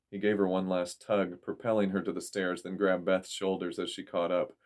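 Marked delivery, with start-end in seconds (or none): none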